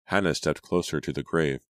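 ordinary delivery

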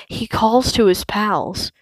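ordinary delivery, nothing marked

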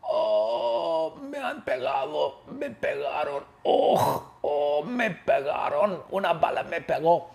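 hammed-up wailing of a wounded man; Valentín. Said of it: "Valentín grita".

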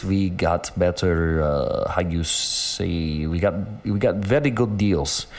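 slow mock Russian accent, groping for words. Retelling how he haggled on Canal Street, slow mock Russian accent. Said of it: "put on the Russian accent like that".

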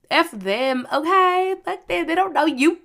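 retold indignant dismissal, rising high and loud; defiant. Girlfriend backing her jailed boyfriend, defiant.

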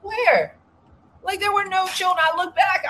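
high, loud disbelief; incredulous outburst. Retold or imitated. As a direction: throw it up high in outrage. Outraged retelling of hotel clash.

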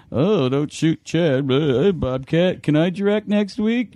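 mocking, drawn-out teasing; busting chops. Cameramen ribbing their director, mock pleading. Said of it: "they're all busting my chops".

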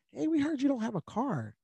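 a caring pastor checking in; pastor's concern. Retold or imitated. Pastor voiced in story.

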